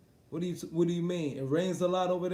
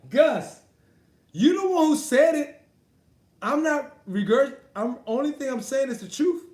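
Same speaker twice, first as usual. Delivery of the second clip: much higher and louder, pressing the point; heated call-out; 8 semitones higher, 8 dB louder than usual. Real, not acted. Boxer calling out fan Gus on stream, loud and indignant. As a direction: raise it at him, indignant.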